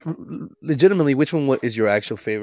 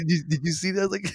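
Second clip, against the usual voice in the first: breathless laughing question; 7 semitones higher than usual. Real, not acted. Still laughing after the scare.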